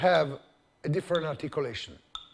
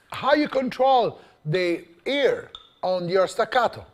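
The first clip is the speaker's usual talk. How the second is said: ordinary talk, nothing marked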